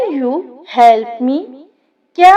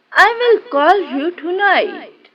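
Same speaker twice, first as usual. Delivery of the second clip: ordinary delivery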